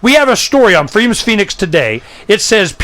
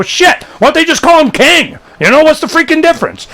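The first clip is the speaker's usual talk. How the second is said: exasperated; 10 semitones higher than usual. Retold or imitated. Outraged at Constitution's powers.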